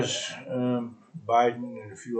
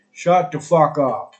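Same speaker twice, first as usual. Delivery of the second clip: angry; 4 semitones higher, 10 dB louder, slower than usual. Real, not acted. Angry rant at a celebrity.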